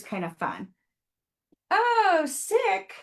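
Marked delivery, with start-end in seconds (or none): 1.7-2.9 high and loud burst of delight; excited exclamation. Real, not acted. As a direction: blurt it out, thrilled. Excited reaction to viewer comment.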